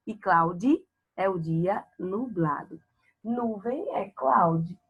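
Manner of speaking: usual speaking voice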